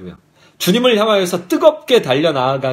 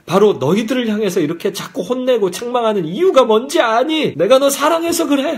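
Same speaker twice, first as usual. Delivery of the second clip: higher-pitched, earnest reproach; insistent explaining; 6 semitones higher than usual. Acted. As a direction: press the point like a scolding parent who loves. Voices the Lord explaining loving rebuke.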